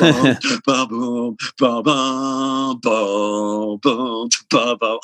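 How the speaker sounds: slow mouthed drum pattern; vocal drum groove. Retold or imitated. Drummer showing the bandmate how it goes: mouthed groove. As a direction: sing the beat back to him.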